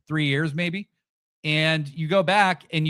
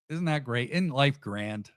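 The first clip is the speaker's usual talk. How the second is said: sarcastic; 6 semitones higher than usual. Real, not acted. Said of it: "everything I say sounds sarcastic".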